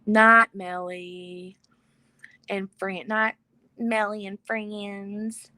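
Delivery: slow, stretched-out farewell; drawn-out goodnight. Real, not acted. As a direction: draw out each word as you sign off. Drawn-out goodnight to departing viewers.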